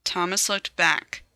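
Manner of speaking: ordinary delivery, nothing marked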